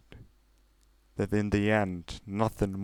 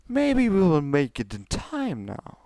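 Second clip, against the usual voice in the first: high, regal princess voice pushing her sister; lofty royal sister, coaxing; Celestia's voice; 8 semitones higher than usual. Acted. Older sister pressing Luna to go on, high and regal.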